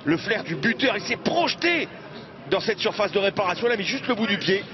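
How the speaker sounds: high, driving goal call; excited sports commentary. Acted. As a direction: celebrate the goal on air.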